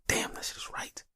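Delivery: soft unvoiced whisper; whispered aside. Admitting his father's rule made sense, whispered. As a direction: whisper it like a guilty admission. Quoted inner thought.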